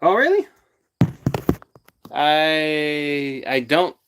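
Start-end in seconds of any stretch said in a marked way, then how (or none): 0.0-0.5 surprised. Real, not acted. Reacting to viewer comment.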